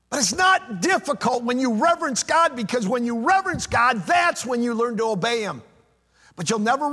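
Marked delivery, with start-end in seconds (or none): 0.1-5.6 raised, singing preacher cadence, higher and louder; fervent preaching. Acted. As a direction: preach it out to the congregation with conviction. Preaching climax on reverence.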